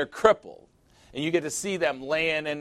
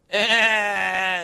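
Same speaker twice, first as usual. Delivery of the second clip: mock whimper; 6 semitones higher, 4 dB louder than usual. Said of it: "the kid picks up that cue and goes".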